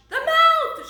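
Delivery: very high, loud shriek; high-pitched storybook scream. A narrator's reading. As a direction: shriek it as frightened George. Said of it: "shrieked George".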